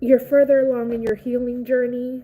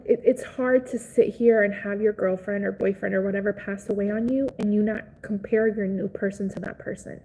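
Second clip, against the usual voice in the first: normal talking voice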